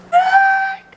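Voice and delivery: high, loud disbelieving squeal; a sudden high "What?" of delight; shocked squeal. Real, not acted. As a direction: shriek it in sheer disbelief. Shocked discovery in gift box.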